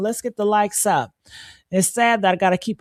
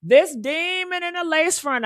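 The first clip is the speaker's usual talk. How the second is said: rising outrage; 7 semitones higher than usual. Real, not acted. Building outrage, repeating phrase.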